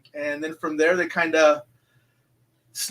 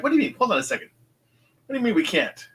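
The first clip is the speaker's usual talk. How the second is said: mildly incredulous, higher-pitched question; retold disbelief; 7 semitones higher, faster than usual. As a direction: act out baffled protest, lightly. Acts out own disbelief at friends.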